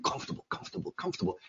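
hushed galloping repetition; horse-riding rhythm. Shown as a demonstration. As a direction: repeat it softly like hoofbeats. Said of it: "it sounds like you're riding a horse".

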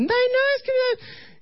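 very high falsetto mock panic squeal; panicked girlfriend. Girlfriend screaming as zombies pop out, mock panicked shriek. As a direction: squeal helplessly in a put-on female voice. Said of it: "mi novia gritando, asustada, con pánico".

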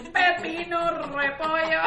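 laughing mock vendor cry; street vendor call. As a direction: hawk the vegetables loudly. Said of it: "como los camiones esos que iban en la calle".

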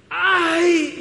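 sudden loud high yelp of pain. Acted. Preacher acting out a hammered finger, loud pained yelp. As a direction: cry out as if the hammer hit the finger.

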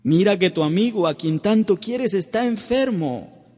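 high, loud urgent plea, slowing at the end; Martha's message. Retold or imitated. Martha's message to Jesus about her sick brother, high urgent plea. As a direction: send word that the friend is ill, urgently. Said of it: "mandó decirle a Marta".

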